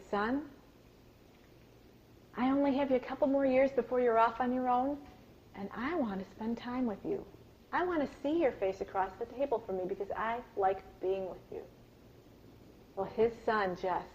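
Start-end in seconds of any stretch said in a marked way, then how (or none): 0.1-11.6 much softer, sincere words to his son; hushed heartfelt father's plea; tender confession. Retold or imitated. Father tells son his love.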